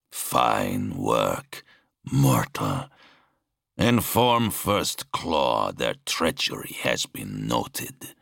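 very low, slow master's voice; deep, deliberate creature addressing a lesser being; approving monster lord. A narrator's reading. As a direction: commend and command slowly from the throne, pitched low. The Exalted praises and orders.

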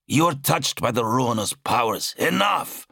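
high-pitched accusation rising to a cry; angry outburst. Acted. Zal accuses his brother of corruption, angrily. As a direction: cut him off, pitch leaping up.

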